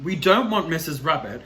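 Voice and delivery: plain reading voice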